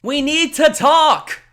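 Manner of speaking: loud, high, drawn-out holler; calling out. Acted. Man calls to departing girl, insistent shout. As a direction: holler it slowly across the distance.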